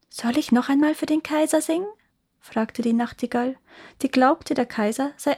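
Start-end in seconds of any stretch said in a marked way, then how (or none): 0.1-1.9 high-pitched questioning bird; little nightingale. A narrator's reading. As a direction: ask politely in a small bird's high voice. Nightingale offers to sing. (hohe, fragende Nachtigallenstimme)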